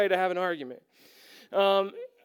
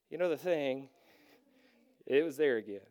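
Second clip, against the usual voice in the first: low, soft, gentle correction; calm wife; 7 semitones lower, 7 dB quieter than usual. Retold or imitated. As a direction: point it out softly once tempers cool. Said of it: "once I was calm, she'd be like".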